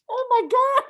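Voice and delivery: high, loud retold shriek of amusement; exclaimed disbelief. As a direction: burst out, re-enacting helpless hilarity. Her helpless reaction to deadpan joke.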